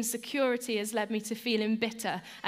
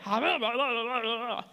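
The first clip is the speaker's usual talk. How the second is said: annoyed babble; 3 semitones higher, 3 dB louder than usual. Shown as a demonstration. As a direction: spill out annoyed nonsense babble. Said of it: "That's what I do when I'm annoyed".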